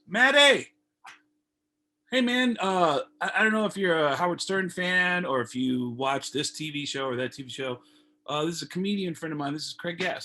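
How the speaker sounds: friendly hollered introduction; calling out. Retold or imitated. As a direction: shout his name across the space, then chatty intro. Calling across backstage patio.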